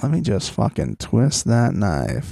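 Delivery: low, slow imitated spite; mock cruel relish. Imagining her rubbing it in to crying Mariah, mock relish. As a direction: draw it out low, twisting the knife. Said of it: "She's like".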